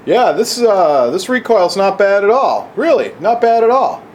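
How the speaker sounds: sneering impression of a reckless gun YouTuber; mocking a careless shooter. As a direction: ham up a show-off bragging about recoil. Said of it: "somebody's doing, doing the".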